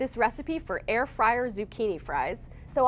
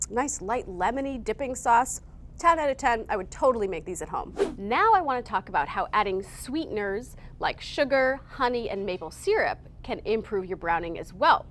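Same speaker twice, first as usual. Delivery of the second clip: usual speaking voice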